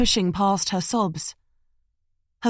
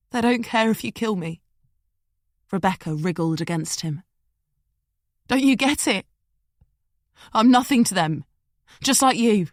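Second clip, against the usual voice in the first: desperate woman; 2 semitones higher than usual. Acted. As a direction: make him understand. Rebecca struggling and pleading.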